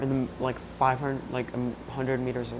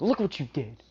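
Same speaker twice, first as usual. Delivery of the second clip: mock angry scolding, pitched up; acted indignant rebuke, a bit louder; scolding outburst; 3 semitones higher, 4 dB louder than usual. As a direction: scold them for upsetting your child. Father scolds those who upset child.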